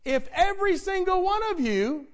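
high, loud preacher's prompt; forceful appeal. Real, not acted. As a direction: push the congregation to respond. Repeats the hint forcefully.

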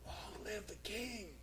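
muffled call from beneath the stone; Daniel answering faintly from inside the den. Retold or imitated. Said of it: "a muffled voice came out from beneath that stone".

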